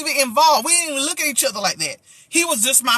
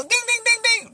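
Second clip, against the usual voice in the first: high ding sound effect; 11 semitones higher than usual. Pretend.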